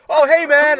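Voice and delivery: high, loud friendly holler; imagined shouted greeting. Retold or imitated. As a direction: act out hailing a friend from afar.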